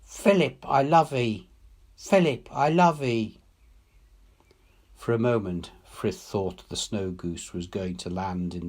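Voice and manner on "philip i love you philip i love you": higher, aching cry of love; heart answering. A narrator's reading. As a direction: call back to him through tears. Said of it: "her heart was answering".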